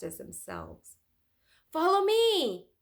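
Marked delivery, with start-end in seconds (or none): 1.7-2.7 loud, high, slow call; crying out. Acted. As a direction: lead the way with a cry. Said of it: "cried Leonardo".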